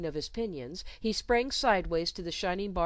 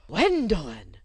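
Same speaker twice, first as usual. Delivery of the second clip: shocked gasp of the name, louder; breathed in shock; 9 dB louder than usual. Acted. Governess shocked by the outburst, breathed name. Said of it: "breathed Miss Royle".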